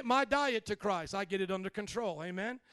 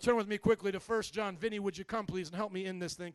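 ordinary talk, nothing marked

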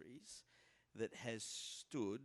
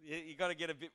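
ordinary delivery

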